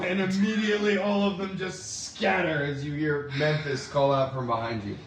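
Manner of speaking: ordinary delivery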